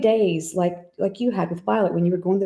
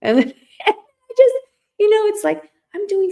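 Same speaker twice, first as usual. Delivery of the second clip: much higher, crying through a laugh; real emotion, voice breaking; choked up; 13 semitones higher, 5 dB louder than usual. Real, not acted. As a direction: let the tears break in. Moved to tears by son.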